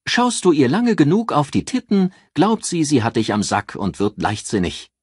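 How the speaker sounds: mocking sarcastic jab, high and loud; dripping with sarcasm. A narrator's reading. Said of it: "mit einem sarkastischen Ton".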